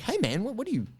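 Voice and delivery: high, mocking gym bro ranting; angry gym bro. Teller mimicking the gym bro who attacked the arborists, high and mocking. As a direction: play the attacker as a shrill lout. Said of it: "stepped in like".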